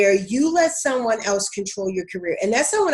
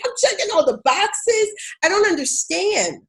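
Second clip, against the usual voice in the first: exasperated self-quote; 10 semitones higher than usual. Retold or imitated. Retelling her job-search frustration, high exasperated whine. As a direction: pitch it way up like you can't believe it. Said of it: "I was like".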